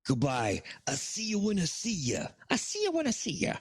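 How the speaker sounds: high, fast, put-on old New York gangster accent. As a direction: toss off a cocky goodbye in a 1920s New York accent. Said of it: "I can't do his accent".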